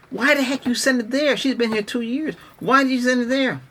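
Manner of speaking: high, exasperated complaint. Retold or imitated. About the Mesh sent to another state, exasperated. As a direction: throw the question up in disbelief. Ranting about wrong delivery address.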